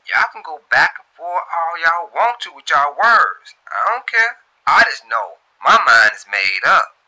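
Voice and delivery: put-on high, loud, fed-up young woman; defiant Shantae. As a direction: shut your friends down, mind made up. Shantae answering her friends.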